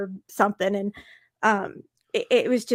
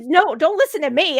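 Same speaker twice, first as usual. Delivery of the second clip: higher, louder hasty objection; acted-out insistent "no, no"; retold protest; 6 semitones higher, 7 dB louder than usual. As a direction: cut in emphatically to wave it off. Acted quote, waving away his remark.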